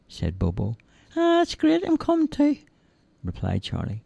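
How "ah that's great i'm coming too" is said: put-on high, childlike simple lad voice; cheerful high-pitched lad; Charlie's voice. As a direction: play the big innocent lug, high and eager. Charlie eager.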